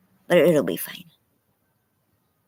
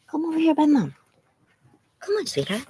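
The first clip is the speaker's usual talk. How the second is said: high, coaxing call to the dog; 11 semitones higher, faster than usual. Real, not acted. Owner calling her scared dog to come close.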